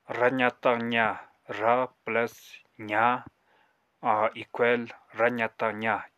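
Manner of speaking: र जतक जा, slowly, syllable by syllable; slow demo reading. Shown as a demonstration. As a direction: sound it out for the class. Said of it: "करके पढ़ते हैं". (धीमे-धीमे अक्षर जोड़कर पढ़ना)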